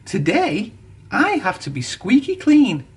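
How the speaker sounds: a proud engine insisting he must be clean; huffy. A narrator's reading. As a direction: insist on your own importance. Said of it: "huffed Thomas".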